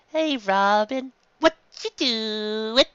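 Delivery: put-on high, slow, curious boy voice; Luffy cheerful. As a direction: stretch it out with a grin. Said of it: "he asked with a smile".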